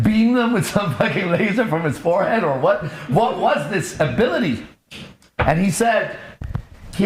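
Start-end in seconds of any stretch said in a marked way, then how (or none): 0.0-4.7 higher, incredulous sarcastic jab; retelling his sarcastic question to the auditor; mock incredulous. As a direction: scoff at the idea of a superpower. Mocking retold question to superior.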